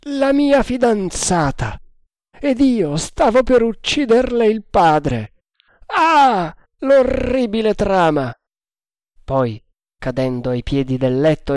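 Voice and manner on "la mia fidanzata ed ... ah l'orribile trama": high, drawn-out anguished outcry; cry of horror. A narrator's reading. Tremal Naik nearly killed his fiancée's father, horrified cry. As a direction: wail it in acted horror. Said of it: "emettendo un grido di orrore".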